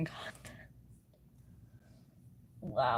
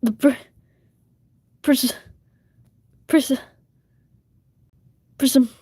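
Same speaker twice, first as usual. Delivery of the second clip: louder, higher calls to the cat; calling out; 6 semitones higher, 10 dB louder than usual. Real, not acted. Streamer calling her cat Prism, calling out. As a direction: call the name out across the room.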